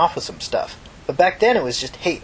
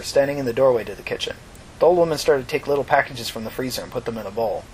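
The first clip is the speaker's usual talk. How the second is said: ordinary delivery, nothing marked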